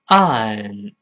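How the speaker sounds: ON drawn out, tongue root held still; model pronunciation. Shown as a demonstration. Teacher repeating on for the class, drawn-out model pronunciation. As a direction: say "安" long and falling, tongue still. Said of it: "注意舌根不能动啊".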